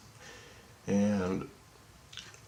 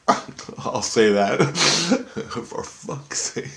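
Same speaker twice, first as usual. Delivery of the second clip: laughing; 5 semitones higher, much slower than usual. Real, not acted. Speaker swapping a mild oath for a curse, laughing. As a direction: say it through a laugh.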